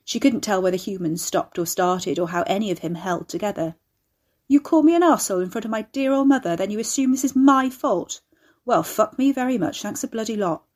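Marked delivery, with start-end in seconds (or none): 4.5-10.6 high, loud sarcastic outrage; offended, sarcastic tirade; indignant rant. A narrator's reading. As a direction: rail at her, aggrieved. Ricky rants, offended at Carrie.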